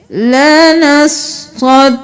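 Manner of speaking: slow, every doubled letter said twice; لَنَسْقَدَّ with the دّ held long. Shown as a demonstration. Teacher showing students the doubled letters, slow. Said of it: "دو دو بار ادا کرنا ہے".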